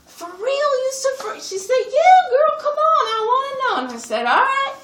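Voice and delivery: can't contain her delight; overjoyed squeal. Acted.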